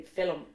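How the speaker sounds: film in two syllables, FILL-um; Hiberno-English helper vowel. Shown as a demonstration. Host shows how Irish English says it, with helper vowel. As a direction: add an uh between l and m in film. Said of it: "Is that helper vowel the same as we have in Hiberno English?".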